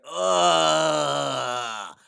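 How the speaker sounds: long, loud, drawn-out "ugh"; disgusted groan. Acted. Whole train reacting to a deadly fart, loud disgusted groan. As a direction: groan loudly as if hit by a stench. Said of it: "Everyone's like".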